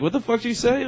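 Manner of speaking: high-pitched question at ordinary volume; retold unaggressive protest; not mean. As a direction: challenge him without sounding mean. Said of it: "I wasn't even, like, being, like, a mean tone".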